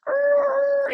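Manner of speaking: high yelled "Err", cut short; a sudden shrill cry of alarm from the other car; mock angry yell. Angry driver honking, cry shout.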